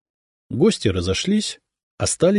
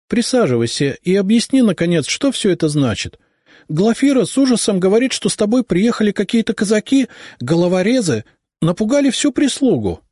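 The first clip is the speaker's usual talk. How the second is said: high, louder mother demanding an answer; a worried noble mother pressing her son; alarmed mother; 12 semitones higher, 3 dB louder than usual. A narrator's reading. Mother demanding answers from her returning son, alarmed.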